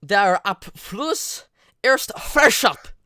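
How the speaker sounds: slow, loud, halting German; halting phrasebook tourist. Shown as a demonstration. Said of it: "That was pretty bad pronunciation".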